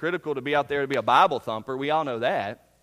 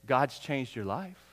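gentle testimony; 5 semitones lower, 4 dB quieter, slower than usual. Acted. Example testimony line.

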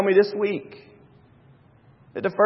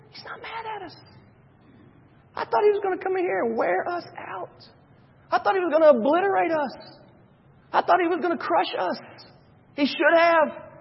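Moses relieved; 11 semitones higher than usual. Retold or imitated. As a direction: breathe it out as a stunned Moses. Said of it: "You can only imagine that Moses is going".

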